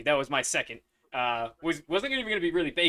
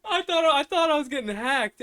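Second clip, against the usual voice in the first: laughing; 12 semitones higher, 10 dB louder than usual. Real, not acted. Laughing over the glitched audio.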